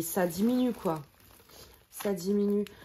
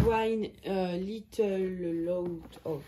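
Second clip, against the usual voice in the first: English read haltingly in a French accent; slow, hesitant English with French accent; 2 semitones lower, much slower than usual. Real, not acted. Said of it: "Désolée pour mon accent anglais".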